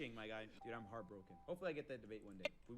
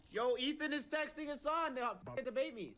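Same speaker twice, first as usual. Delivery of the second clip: excited; 12 semitones higher, 10 dB louder than usual. Real, not acted. Excited announcing texting.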